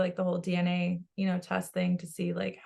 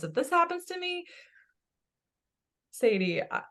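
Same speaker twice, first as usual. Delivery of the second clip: ordinary talk, nothing marked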